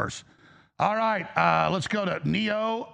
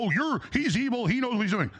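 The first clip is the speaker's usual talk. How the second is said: higher, sneering mimic of critics; mocking critics; 6 semitones higher than usual. Host mimicking people who call Trump evil, higher and sneering. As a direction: toss it off like a know-it-all critic. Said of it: "People go".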